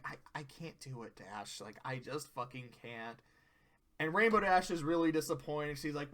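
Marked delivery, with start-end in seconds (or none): 0.1-3.2 soft timid voice. Retold or imitated. Fluttershy refusing, voiced quietly.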